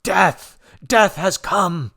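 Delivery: high, loud slow cry; crowd shouting. A narrator's reading. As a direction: shout it across the garden. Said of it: "We could hear the shouts".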